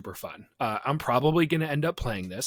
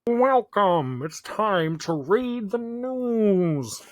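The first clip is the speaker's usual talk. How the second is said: put-on high, slow old-time announcer; drawn-out newsreel anchor read; newscaster voice; 8 semitones higher, 4 dB louder, much slower than usual. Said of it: "give us your best newscaster voice".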